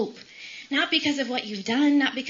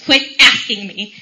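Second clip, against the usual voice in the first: loud, exasperated retort; re-enacted irritated outburst; fed-up with mom; 10 dB louder than usual. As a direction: act out a grown child losing patience, loudly. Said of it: "asked her to stop asking me".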